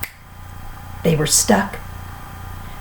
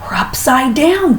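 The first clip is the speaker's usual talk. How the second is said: gasped; 7 semitones higher than usual. A narrator's reading. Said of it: "gasped Mister Twit".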